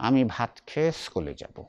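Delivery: "আমি ভাত খেয়ে স্কুলে যাব" said softly with teeth pressed together; through clenched teeth. Shown as a demonstration. Teacher mocking students who speak "আমি ভাত খেয়ে স্কুলে যাব" through clenched teeth. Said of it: "দাঁতে দাঁত চেপে".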